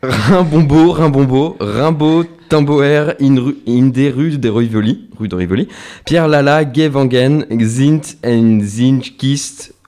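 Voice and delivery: put-on French host stumbling through a Dutch poem with a poor Dutch accent; clumsy Dutch accent. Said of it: "qui va adorer mon accent".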